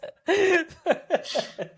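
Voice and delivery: high-pitched burst of giggling; amused laughter. Real, not acted. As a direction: laugh helplessly at something relatable. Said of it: "I'm not laughing at you".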